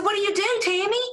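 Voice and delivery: high, chirpy voice of a friendly stranger; Rachel. Retold or imitated. Seatmate greeting the speaker on the plane, high chirpy voice. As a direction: pitch way up, bubbly and eager. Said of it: "she said".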